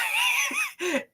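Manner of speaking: very high, squealing laugh; amused high-pitched giggle. Real, not acted. Amused by stash comment.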